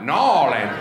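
Nawlin swallowed thick, like choking on a peanut. Shown as a demonstration. Comic repeats the local pronunciation, choked sound. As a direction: gag out Nawlin from the throat. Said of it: "like you're choking on a peanut".

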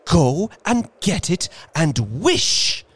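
high, loud, quivering with excitement; trembling with eagerness. Acted. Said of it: "quivering with excitement".

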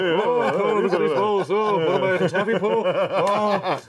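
high, manic comic impression; Robin Williams. Comic playing up a Robin Williams riff for friends, high manic impression. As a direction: riff excitedly in a high, put-on voice. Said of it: "you almost did Robin Williams".

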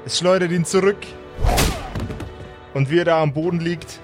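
ordinary delivery

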